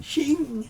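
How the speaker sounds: high ringing shing of a blade; sword being drawn. Acted. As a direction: voice a sword sliding out of its sheath. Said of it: "You hear the sound of a sword being drawn".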